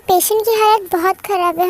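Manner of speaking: ordinary talk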